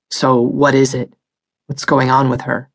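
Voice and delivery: demanding. Acted. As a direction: press hard for answers. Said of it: "the second voice demanded".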